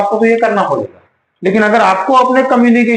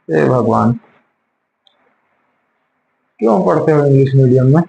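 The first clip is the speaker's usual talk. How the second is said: low, despairing sigh of words; real exasperation; 8 semitones lower than usual. Real, not acted. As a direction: drop the pitch, despairing of the pupil. Teacher despairs at pupil's wrong answer.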